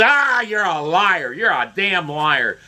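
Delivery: slow, snarling accusation; really angry. Retold or imitated. Neighbor calling him a liar, seething slowly. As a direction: drag out the insult, seething. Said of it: "just really angry".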